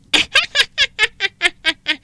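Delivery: high gleeful cackle; giantess cackle. Acted. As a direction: cackle with delight. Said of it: "Mụ ta cười khoái chí".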